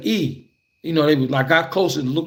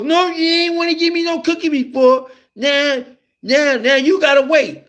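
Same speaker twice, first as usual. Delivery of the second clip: put-on taunting kid; 11 semitones higher than usual. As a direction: make him wait, smugly. Friend turns the tables.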